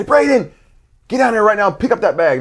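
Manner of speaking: high, loud barked command; coach yelling order. Retold or imitated. Coach to kid who left his bag out, yelling. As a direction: yell sharply at a player who ignored you. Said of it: "screamed at you like that".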